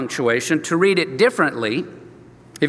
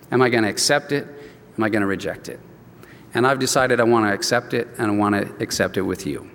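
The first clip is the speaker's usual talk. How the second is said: retold emotional confession; 6 semitones lower, 3 dB quieter than usual. To his pastor, Joe quietly confesses his new faith, lightly emotional. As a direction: lower and softer, a mild choked-up hush. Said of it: "he's very emotional".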